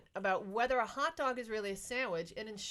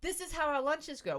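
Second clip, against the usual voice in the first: normal talking voice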